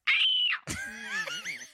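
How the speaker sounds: very high shouted screech; pterodactyl screech. Retold or imitated. After nearly dropping a cup, high pterodactyl screech. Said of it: "I was like".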